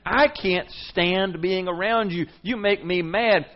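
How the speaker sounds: loud, high, slow angry declaration. Retold or imitated. Preacher voicing Jesus fed up with religious people, slow loud anger. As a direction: spell out his fury slowly. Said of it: "I'll tell you what he's saying".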